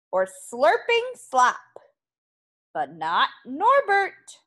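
lively, expressive picture-book narration; story reading for children. A narrator's reading. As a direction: read it to a class of little kids. Reads picture book to pupils.

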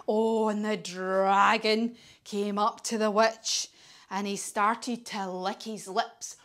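ordinary delivery

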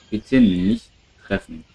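ordinary delivery

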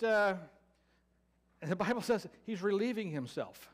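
ordinary talk, nothing marked

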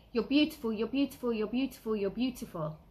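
repeated tender praise to water; passionate affirmation. Retold or imitated. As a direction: pour love into the words. Words said to water.

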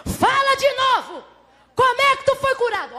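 acted high-pitched demand; demanding interrogation. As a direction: summon the blind man and demand his answer. Aí perguntam para ele.

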